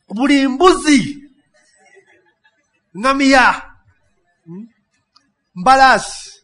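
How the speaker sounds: loud, high animal roll call; excited shout. Acted. Animals list in Swahili, excited.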